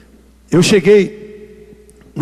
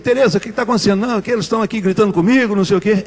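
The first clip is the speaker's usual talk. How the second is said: rapid retold exchange; faster than usual. Quick dialogue with the woman.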